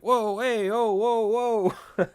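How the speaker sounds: high, drawn-out mock mobster whoas; sing-song imitation wiseguy commotion; Dennis and Mac. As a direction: wail ohs like wannabe mobsters. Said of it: "it's just Dennis and Mac".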